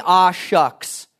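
high, mock-bashful aw-shucks. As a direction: toss it off like a shy kid. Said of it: "He doesn't say".

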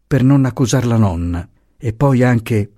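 plain reading voice

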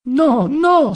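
high, hurried wailing protest; tearful protest. A narrator's reading. Poor Adriana hastens to object, tearful protest. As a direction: protest at once, high and distressed. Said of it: "s'affrettò a protestare piangendo".

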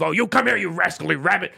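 lightly voiced tight-lipped tough guy; a hint of a gruff Cockney actor; tight-lipped Bob Hoskins. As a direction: clench the mouth, mock gruff. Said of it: "tight-lipped kind of".

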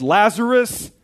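scripture read as a ringing summons; loud cry. A narrator's reading. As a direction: call it out loudly, leaving space before the command. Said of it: "Jesus cried out in a loud voice".

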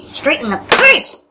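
drawn-out rising battle cry; excited battle cry. Acted. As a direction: roar the attack with glee. Attack declaration.

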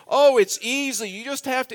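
high, lightly voiced coaxing man; eager instructor. Cart man talking the preacher into riding, retold coaxing. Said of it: "he started giving me a lesson".

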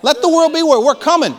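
high, loud warning to the world; rallying cry. Real, not acted. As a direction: proclaim it like a battle cry.

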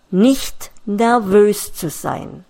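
ordinary delivery

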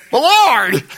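high, loud cry of but Lord; alarmed protest. Retold or imitated. Preacher voicing Ananias protesting to God, high and loud alarm. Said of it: "I can hear this conversation".

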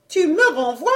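high grating question from the wife; grating challenge. A narrator's reading. Wife asks if she's being sent away, high grating voice. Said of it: "grinça Eudoxie".

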